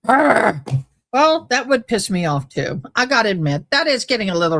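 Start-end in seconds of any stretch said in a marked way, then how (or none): 0.1-0.6 imitated angry arr; mock aggravated grunt; frustrated growl. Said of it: "She's like".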